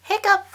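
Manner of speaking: high, loud squeaky hiccup. Acted. Pete hiccups loudly in the story. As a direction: pop out a sudden hiccup.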